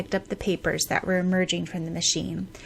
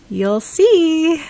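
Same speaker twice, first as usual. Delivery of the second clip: teasing; 9 semitones higher, 8 dB louder than usual. Acted. Teasing reply after laugh.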